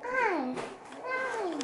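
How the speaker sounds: squeaky helium-high hi. Shown as a demonstration. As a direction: chipmunk voice from helium. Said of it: "This is how you're gonna talk".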